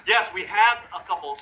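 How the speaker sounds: usual speaking voice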